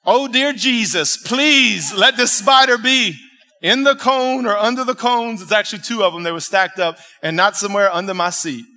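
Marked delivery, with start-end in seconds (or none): 0.1-5.4 loud, slow mock-desperate plea; pleading prayer. Man begging Jesus about a hidden spider, mock-pleading. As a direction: drag out the plea, pitched high.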